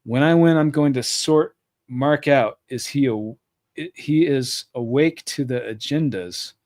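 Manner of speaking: usual speaking voice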